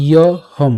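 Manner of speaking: "ইয়হম" slowly, as iyo, then hom; y-glide pronunciation. Shown as a demonstration. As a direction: say "ইয়হম" in two parts with a clear y. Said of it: "উচ্চারণ হবে হচ্ছে ইয়".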